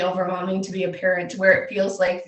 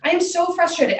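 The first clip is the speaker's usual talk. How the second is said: higher-pitched, exasperated retelling without real tears; retold tearful frustration; 8 semitones higher than usual. Mom admitting to her daughter she's crying, retold frustration. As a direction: re-enact being at your limit. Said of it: "just started fucking sobbing. Not sobbing".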